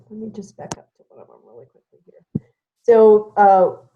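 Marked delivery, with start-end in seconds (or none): 0.1-2.4 very soft, low, hurried aside; talking to oneself. Real, not acted. Host finding a chat question, quiet self-talk. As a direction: trail down under your breath while searching.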